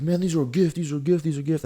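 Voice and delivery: high-pitched defensive insistence, repeating. Retold or imitated. Narrator mimics the thief defending the stolen shoes, high and defensive. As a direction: imitate the thief protesting over and over. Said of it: "he got all defensive".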